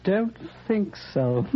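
ordinary delivery

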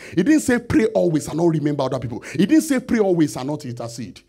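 loud, driving sermon cadence on didn't; preaching at full force. Acted. Preacher pounding the point home to the congregation, loud. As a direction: hammer each line home from the pulpit.

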